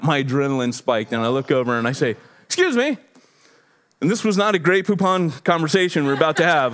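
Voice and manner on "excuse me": high, strained mock politeness; trying to be cool. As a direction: re-enact a tense attempt at staying cool. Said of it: "I'm trying to be cool about it".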